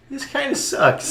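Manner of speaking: glum quote. Retold or imitated. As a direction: drag it out, half-joking disappointment. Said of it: "And I was like".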